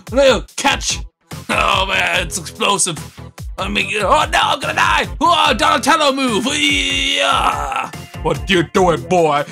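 ordinary delivery

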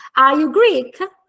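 "Are you Greek?" slow, loud, arching high on Greek; umbrella intonation. Shown as a demonstration. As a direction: push "Greek" up high as a model question. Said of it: "Mi si alza l'ombrello?".

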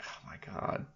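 very soft whispered exclamation; hushed disbelief. Retold or imitated. Co-host recalling his reaction to the ridiculous video, hushed disbelief. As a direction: whisper it under your breath, appalled.

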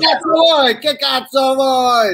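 high, shouted Italian street insult; mock Italian tough guy. Playing an Italian mobster at friends, hammed-up angry Italian shout.

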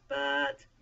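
loud, drawn-out objection; hesitant objection. A narrator's reading. Moses begins another excuse to God, objecting. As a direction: hang loudly on the word, hesitating.

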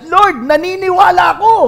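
very high, loud retold cry; mock-earnest cry of belief; acted declaration. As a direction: proclaim it high and loud, imitating a plea. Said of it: "Kasi sabi niya".

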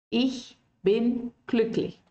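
Ich bin glücklich said slowly, each word clear; slow German model phrase. Shown as a demonstration. Teacher to students, a slow clear model line. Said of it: "decir ich bin glücklich".

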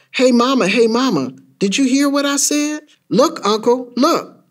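put-on high, eager kid demanding attention; insistent child. Child in the car calling Mama and Uncle, insistent. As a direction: pester the grown-ups to look.

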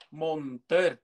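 "mồn tơ" said as mon-teh, the d dropped into a t; teacher modeling "mồn tơ" slowly; common pronunciation. Shown as a demonstration. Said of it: "người ta hay đọc là mồn".

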